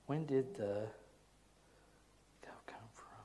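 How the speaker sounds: rereads it, trailing into a breathy-free whisper on the end; puzzled whispered reread. Real, not acted. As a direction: mutter it back to yourself in disbelief.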